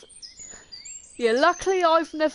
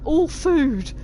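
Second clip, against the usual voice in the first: excited dogs; 5 semitones higher, 4 dB louder than usual. Retold or imitated. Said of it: "they'll think".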